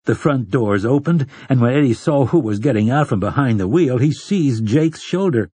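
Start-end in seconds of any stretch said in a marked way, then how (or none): none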